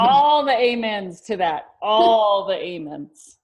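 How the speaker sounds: loud, slow, drawn-out amens like in church; amen shout. Real, not acted. Cheering the follow-up advice with loud slow amens. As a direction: preach it back to the speaker. Church-style affirmation to team.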